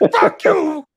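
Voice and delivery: high, loud drunken-heckler imitation; acted taunting shout. Drunk sailor driving by the gate guards, acted taunting shout. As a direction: holler it at guards from a passing car.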